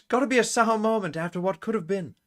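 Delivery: put-on high, affected British accent; Kiko, pansy British. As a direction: ham up a British player, pitched well up. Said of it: "It's pansy British".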